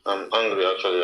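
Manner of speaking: ordinary talk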